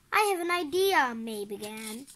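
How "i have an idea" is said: high, eager call. A narrator's reading. May trying to get the family's attention, calling out. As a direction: pipe up over the noise.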